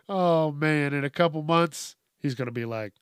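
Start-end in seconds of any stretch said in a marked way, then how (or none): none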